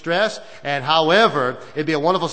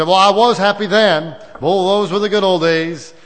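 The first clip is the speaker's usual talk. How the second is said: wistful complaint; 5 semitones higher, 7 dB louder than usual. Retold or imitated. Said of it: "But we say".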